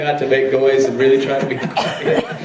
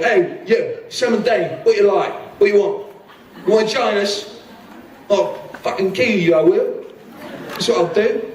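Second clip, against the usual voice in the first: put-on higher-pitched East End tough-guy accent; East End brawler; 6 semitones higher than usual. An audition as a scruffy brawler, higher East End accent. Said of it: "an East End brawler".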